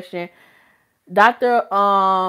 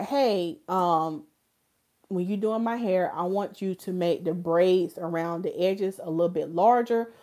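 softer, polite, gentle ask; sweet request; 2 semitones lower, 6 dB quieter than usual. Shown as a demonstration. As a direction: ask nicely with the love of Christ. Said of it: "let me show the love of Christ".

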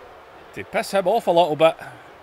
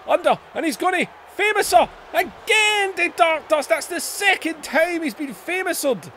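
excited commentator shout; 13 semitones higher than usual. Real, not acted. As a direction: sell the finisher like a TV announcer. Finisher called by commentator.

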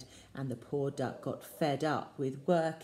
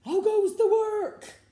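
very high, loud startled call; the woken farmer calling out; 9 dB louder than usual. A narrator's reading. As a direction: yell it as the bed rocks. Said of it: "and he called".

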